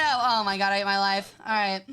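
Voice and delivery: ordinary talk, nothing marked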